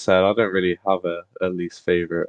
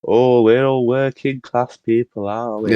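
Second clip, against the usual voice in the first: slow, hammed-up northern advert voice in a mock northern accent; a cheesy advert voice-over faking a northern accent; 4 semitones higher, slower than usual. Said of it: "adverts when they put on, like, a northern accent".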